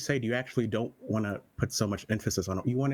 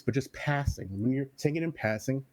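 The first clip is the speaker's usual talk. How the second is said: normal talking voice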